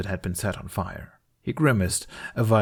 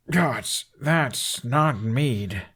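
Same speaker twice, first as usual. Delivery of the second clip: coughing, words slow and strained; 4 semitones higher, much slower than usual. A narrator's reading. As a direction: sputter it out after a harsh drink. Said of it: "Hiccup coughed".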